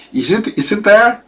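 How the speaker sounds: high, worried question; mock uneasy girl voice; anxious girl. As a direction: ask nervously like a scared girl. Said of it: "She's a little bit concerned".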